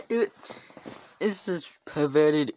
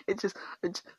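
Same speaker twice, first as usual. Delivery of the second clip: soft unvoiced whisper; a hushed whisper; 6 semitones higher, 7 dB quieter, faster than usual. Real, not acted. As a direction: breathe it out without voice. Whispered aside.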